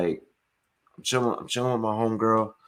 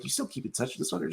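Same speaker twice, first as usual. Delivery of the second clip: quietly incredulous; 4 semitones higher, 10 dB quieter, faster than usual. Retold or imitated. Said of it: "I'm like".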